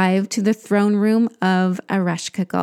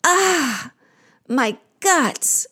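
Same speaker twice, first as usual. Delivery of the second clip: sighing; 3 semitones higher, much slower than usual. Acted. Queen of the underworld in pain, sighing. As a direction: sigh that starts high and falls. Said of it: "Ereshkigal sighs".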